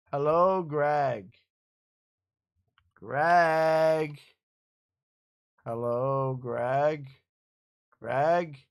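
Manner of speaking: shouting hello into the mic; loud calling. Real, not acted. Repeatedly calling friend on call.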